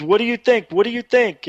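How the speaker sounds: high, insistent repeated question; excited dad. Retold or imitated. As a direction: press for an answer, imitating an eager father. Excited dad pressing for an opinion.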